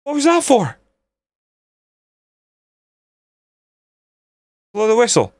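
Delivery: high, incredulous outcry; indignant protest. Real, not acted. Gamer protesting at the referee, high indignant cry. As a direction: demand the referee step in.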